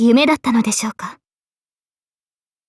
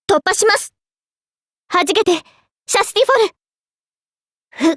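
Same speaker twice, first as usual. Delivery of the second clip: high-pitched charging call; battle cry; 6 semitones higher, slower than usual. Acted. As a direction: call the attack out high, without extra volume. Charging and naming an attack. (高い声の戦闘の掛け声)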